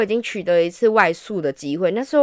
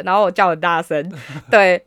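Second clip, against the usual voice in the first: ordinary talk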